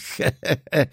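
short acted gloating laugh; scheming little chuckle; malicious chuckle. A narrator's reading. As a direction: laugh spitefully to oneself. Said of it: "złośliwy uśmiech nie schodził".

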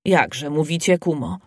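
ordinary delivery

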